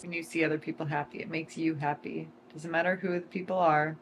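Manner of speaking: usual speaking voice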